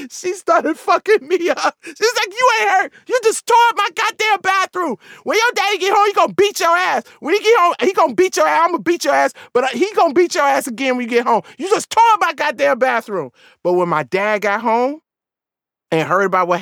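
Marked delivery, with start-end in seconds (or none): 2.3-13.3 high-pitched, ranting scold; mom's angry tirade. Retold or imitated. Mom scolding over the torn-up bathroom, high angry rant. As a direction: rail at the kid like a furious mother, hammed-up.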